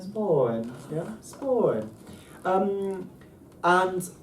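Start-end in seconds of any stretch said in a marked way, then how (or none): 0.0-1.9 SPAWN drawn out with a glide; up-and-down pitch accent. Shown as a demonstration. Teacher echoes the student's attempt, up-and-down glide. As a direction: bend the pitch through SPAWN. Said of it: "That's the up and down".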